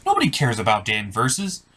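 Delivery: ordinary talk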